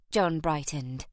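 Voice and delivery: usual speaking voice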